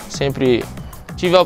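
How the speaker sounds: ordinary talk, nothing marked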